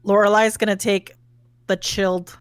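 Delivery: ordinary talk, nothing marked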